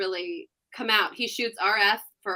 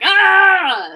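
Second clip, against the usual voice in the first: exasperated groan; 10 semitones higher, 12 dB louder than usual. Retold or imitated. Describing a stressful playoff run.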